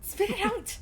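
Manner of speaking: high, sharp yell to spit it out; sharp command. Acted. Character yells at companion.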